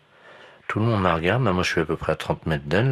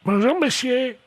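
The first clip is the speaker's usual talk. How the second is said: put-on high quavery old woman; old lady voice; 4 dB louder, slower than usual. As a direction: play a dotty old lady. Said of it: "Tenez, madame".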